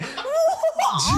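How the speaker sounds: high laughing disbelief; shocked what. Real, not acted. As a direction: shriek it in amused shock. Shocked disbelief at popes voting.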